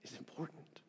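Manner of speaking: hushed unvoiced whisper; preacher whispering to draw the congregation in. Shown as a demonstration. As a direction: whisper it for weight. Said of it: "He whispers to us".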